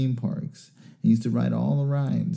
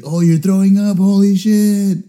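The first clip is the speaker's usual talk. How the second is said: high, loud holler, last words stretched; excited yelling; 9 semitones higher, 6 dB louder than usual. Retold or imitated. Imitating friend seeing him throw up, yelling. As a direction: yell it like a thrilled friend.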